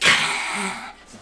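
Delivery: loud, rasping reptile noise; lizard hiss. Acted. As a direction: hiss like a lizard ready to fight. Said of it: "the lizard just".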